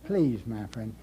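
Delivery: usual speaking voice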